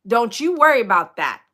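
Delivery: usual speaking voice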